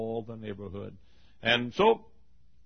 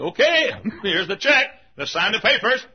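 loud, raised-pitch announcement; eager buyers; 11 semitones higher, 14 dB louder than usual. Acted. Neighbors coming back with the check, loud and high. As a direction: call it out ready to close the deal.

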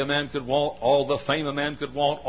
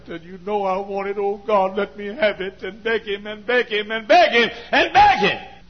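climbing higher and louder each beg; building sermon; 10 semitones higher, 7 dB louder than usual. Acted. Preacher urging the church to beg God, building to full force.

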